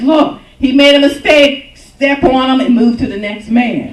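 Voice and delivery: loud, scornful voice of the culture. Retold or imitated. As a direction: bark it out like a cold crowd. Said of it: "The culture now says".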